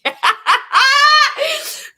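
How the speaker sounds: shrieking burst of real laughter; loud high cackle. Real, not acted.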